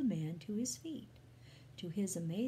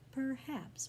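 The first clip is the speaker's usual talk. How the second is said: drawn-out, higher-pitched pondering; slow, hesitant; 6 semitones higher than usual. A narrator's reading. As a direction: say it slowly, as if reluctantly considering. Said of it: "Paul said slowly".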